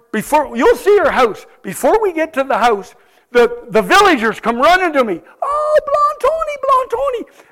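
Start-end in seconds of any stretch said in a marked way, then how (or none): 5.4-7.4 much higher excited calling voice; high urgent villager cry. Retold or imitated. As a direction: call out to Tony as a frightened villager. Villagers come running calling him.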